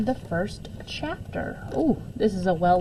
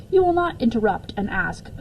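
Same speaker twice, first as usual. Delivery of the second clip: ordinary delivery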